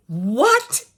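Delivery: loud, rising retold cry of shock; stunned disbelief. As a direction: blurt it high and incredulous. Retold shock at petition news.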